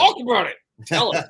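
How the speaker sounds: loud, high urging; excited egging-on. Real, not acted. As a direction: push him eagerly to tell it. Eggs guest on to tell story.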